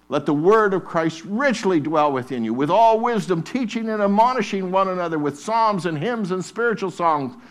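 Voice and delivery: loud, high, repeated "it is written"; preaching at full force. Acted. Preacher urging the church to wield scripture, full force. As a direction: chant it like a battle cry.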